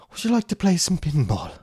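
high, loud mock-Irish invitation; Irish accent. Asking the date to play pinball, put-on Irish accent. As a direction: ask brightly in a hammed-up Irish accent. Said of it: "I work on my Irish accent".

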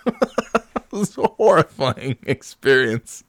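high, slow, through laughter; laughing disbelief. Real, not acted. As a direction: laugh helplessly at the horror of it. Laughing over the horrifying memory.

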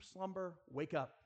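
ordinary delivery

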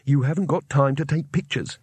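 plain reading voice

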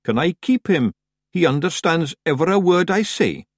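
high Scots Feegle voice; Daft Woolly. A narrator's reading. Feegle begging to keep Horace the cheese, high Scots pleading. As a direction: plead like a small Scottish creature with a pet.

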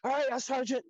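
high, loud goofy voice for the clueless recruit; dopey recruit reply. Retold or imitated. Clown recruit bowing to the sergeant, goofy high voice. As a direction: answer like a cheerful clown who has no clue. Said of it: "And he goes".

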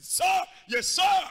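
high, loud soldier reply; shouted yes sir. Retold or imitated. Gideon answering God like a soldier, shouting. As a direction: snap to attention and shout.

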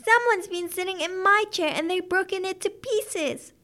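put-on crying out as baby bear. A narrator's reading. Baby bear finding porridge all eaten, crying out high. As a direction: wail it in a high child-bear voice. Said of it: "cried the baby bear".

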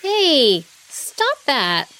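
protesting. A narrator's reading. Dragonfly jabbed by a monkey's fingernail, protesting. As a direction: call out in protest.

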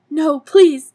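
high, loud, slow pleading; desperate plea. Retold or imitated. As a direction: beg for your life over fashion. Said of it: "She says it so desperately".

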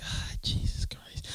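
slow, whispered groan of dread; exasperated whisper. Retold or imitated. Parent dreading the talk, slow whisper. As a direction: breathe it out like you're already tired.

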